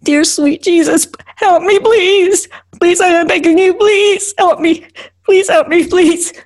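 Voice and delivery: very high, desperate begging; screamed plea. Acted. Driver begs Jesus for help, screamed plea. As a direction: build to frantic, repeated begging. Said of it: "I scream".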